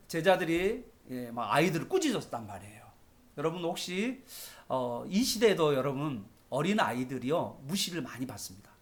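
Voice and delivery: ordinary talk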